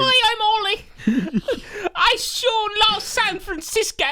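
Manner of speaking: put-on high, slow squeaky old-timer kid; high-pitched boy who sounds like a grandpa; boy voiced like an old man. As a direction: play the boy as a chirpy little old man. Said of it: "He talking like he's an old man".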